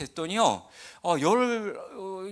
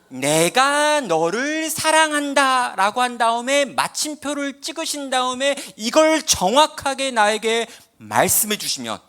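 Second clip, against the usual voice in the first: much louder, higher and very slow, every word pressed; spelling out word by word; 7 semitones higher, 7 dB louder, slower than usual. Retold or imitated. To God, insisting on the exact wording, loud and slow. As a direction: lay down each word and mark slowly and loudly for God. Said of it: "요걸 정확하게 어순을 바꾸면 안 되고".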